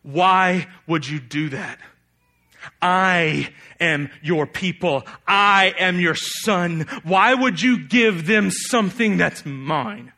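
slow, loud, wounded reproach; bitter Jonah. Acted. Jonah accuses God of giving away his blessing, slow and bitter. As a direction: drag each word out, hurt and resentful. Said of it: "And he was angry".

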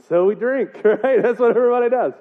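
usual speaking voice

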